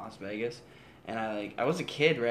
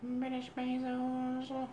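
mock British accent; 13 semitones higher, much slower than usual. Mocking British Bezos.

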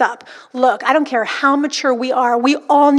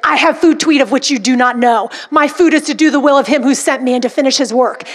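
louder, forceful scripture declaration; talking to a spirit; 3 dB louder than usual. Pretend. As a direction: wield the verse against a pretend foe. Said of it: "as if I was talking to a spirit".

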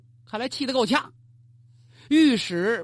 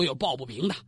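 ordinary delivery

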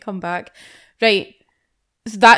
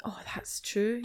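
whispering; 12 dB quieter, slower than usual. Real, not acted. Whispered reply to jibe.

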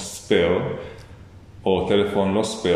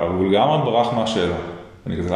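ordinary talk

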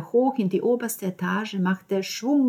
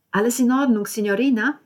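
higher, louder chatty landlady; 5 semitones higher, 6 dB louder than usual. Acted. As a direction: call through the window, higher and louder. Landlady knocks on car window.

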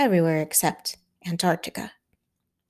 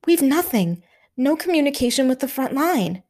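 higher, horrified report; aghast; 6 semitones higher than usual. A narrator's reading. Hamish turning aghast to Stanley as the lines go down. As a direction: let the horror push the voice up. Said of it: "He turned aghast to Stanley".

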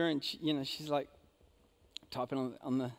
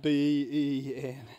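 exasperated spelling; 5 semitones lower, much slower than usual. Retold or imitated. Spelling name for cashier.

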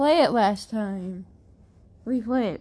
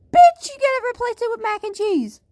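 excited outburst; 10 semitones higher, 4 dB louder than usual. Real, not acted. Hyping a food combo.

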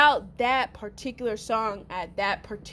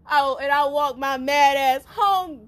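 ordinary talk, nothing marked

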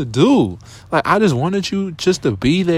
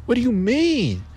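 much higher, baffled disbelief; incredulous; 10 semitones higher than usual. Retold or imitated. Questioning the girl who strung him along, high and baffled. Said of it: "I'm like".

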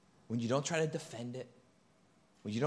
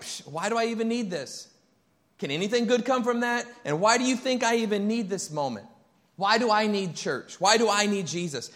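skeptical mock scoff, higher and louder; scoffing Nathanael; 10 semitones higher, 8 dB louder than usual. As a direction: brush it off like a doubter. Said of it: "And Nathanael's like".

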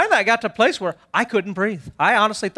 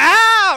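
loud, high hammed-up yelp; exaggerated cry of help; 10 semitones higher, 6 dB louder than usual. Dad fakes injury behind his family, exaggerated cry. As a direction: fake a big cry for rescue. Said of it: "I made an exaggerated cry of help".

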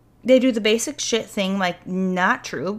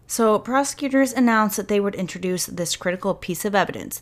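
ordinary talk

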